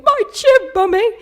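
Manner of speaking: loud, high mock wail; overwhelmed child. Daughter blaming her chimp to her mother, mock overwhelmed wail. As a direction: imitate a little girl in meltdown. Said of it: "she's still overwhelmed from emotions".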